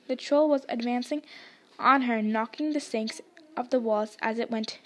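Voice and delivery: plain reading voice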